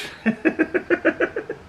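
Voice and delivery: airy high laugh; high breathy giggle. Real, not acted. Laughing at mother's reaction.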